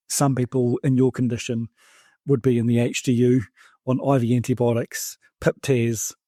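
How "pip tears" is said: ordinary talk, nothing marked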